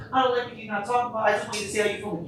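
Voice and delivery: high, quick retold complaint; imitated complaining partner. Man voicing his partner faced with his silence, mock complaining. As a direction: pitch it up and press the complaint.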